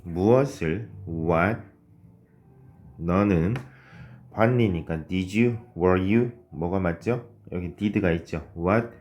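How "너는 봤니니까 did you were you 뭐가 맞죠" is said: normal talking voice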